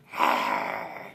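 low, breath-rough tiger growl; imitated big-cat rumble. Shown as a demonstration.

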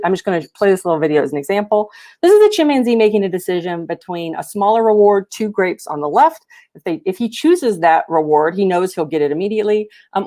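ordinary talk, nothing marked

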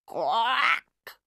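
a single croaking quack; duck quack. A narrator's reading. The strange noise from the diva's throat, a quack. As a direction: croak it like a toad.

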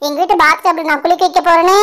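ordinary delivery

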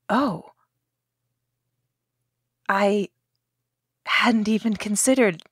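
startled. Acted.

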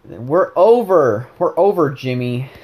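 mock breakup outburst. Partner dumping Jimmy, hammed-up loud breakup. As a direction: cry it out in hammed-up drama. Acted breakup line, movie-style reference.

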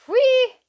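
high, loud squeal; high-pitched teasing whoop; playful whee. Pretend. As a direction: let out a giddy "whee". Teasing about Southern girls.

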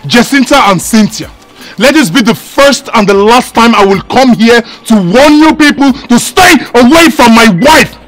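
loud, indignant husband laying down the law; stern angry warning. Acted. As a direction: warn them off your wife forcefully. Husband warns two women off wife.

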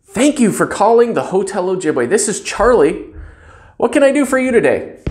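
bright, high, eager hotel greeting; cheerful phone greeting. Shown as a demonstration. Front desk clerk greets a caller, cheerful and high. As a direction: sound glad they called. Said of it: "you don't have to use that exact line".

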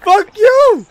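high, loud gamer rage; real high-pitched shouting; raging yell. Real, not acted. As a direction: scream insults at the opponent. Yelling at the game opponent.

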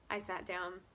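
usual speaking voice

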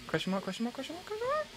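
soft voice climbing extremely high; rising squeaky query. Pretend. Reading out repeated question marks.

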